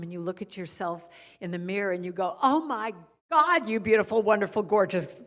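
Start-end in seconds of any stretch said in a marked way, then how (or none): 2.4-5.2 big, loud, hammed-up adoration; gushing self-praise. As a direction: gush extravagantly at your own reflection. Gushing at yourself in mirror.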